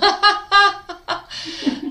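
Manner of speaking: loud, high burst of laughter; real laugh at her own story. Real, not acted. Laughs after acting the phone call.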